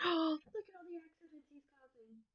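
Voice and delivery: breathless, fast gasp of amazement; high, hushed and hurried like a shocked child; gasping in surprise. Acted. Reader gasps at dog's chaos.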